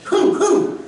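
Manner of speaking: high, short nasal puffs; demonstrated honking nose-blow; imitated nose-blowing. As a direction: mimic blowing the nose in two bursts. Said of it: "코가 많이 나온지 몰라요".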